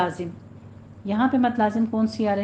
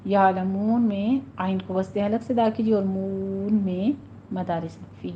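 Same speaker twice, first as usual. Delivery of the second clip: normal talking voice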